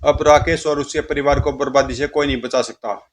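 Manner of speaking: ordinary delivery, nothing marked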